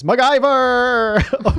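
mock shout, high and stretched out slow; badly acted scream. Imitating a bad actor screaming the name across the border. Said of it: "badly acted moment where someone screams".

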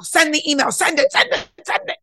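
building to a high, loud urging; rising pep talk. Retold or imitated. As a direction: crank it up, cheering yourself on. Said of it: "And then I go".

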